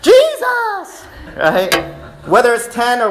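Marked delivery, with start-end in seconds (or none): 0.0-1.1 high, drawn-out sung-out cry; mock chorus shout. Preacher imitating ten lepers calling out, loud high shout. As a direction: call out the name high and long, like a chorus. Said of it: "ten men shouting out simultaneously".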